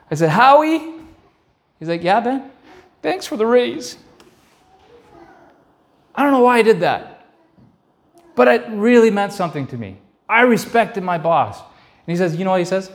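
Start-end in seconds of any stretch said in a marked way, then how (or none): 0.3-0.8 high, rising call of his name; boy calling out to his boss, timid and high. Retold or imitated. 2.0-4.0 put-on much higher, earnest boy's voice; emotional high voice; boyish thanks. Boy thanking boss, emotional. 6.2-11.6 much higher, emotional voice; moved, high and emotional; choked up. Real, not acted. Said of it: "I cried".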